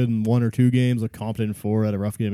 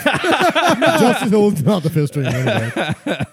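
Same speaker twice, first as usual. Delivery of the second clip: high, slow, hammed-up cry; drawn-out laughing protest; mock outrage; 9 semitones higher, 4 dB louder, much slower than usual.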